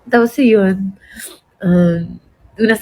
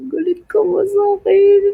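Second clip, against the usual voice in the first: much higher, mock-tearful asking; high half-crying "Galit ka ba sa akin?"; high whiny question; 9 semitones higher than usual. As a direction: squeak it out like she's about to cry. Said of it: "Sabi ko".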